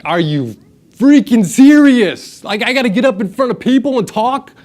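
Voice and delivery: high, loud mock disbelief; freaking out. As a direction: protest in hammed-up panic, stretching the first words. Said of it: "I'm freaking out".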